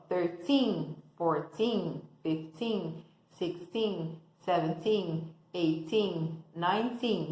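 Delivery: put-on "thirteen" with the second syllable "teen" stressed and long. Shown as a demonstration. Teacher shows students the strong -teen syllable. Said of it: "essa segunda sílaba, ela é a tônica".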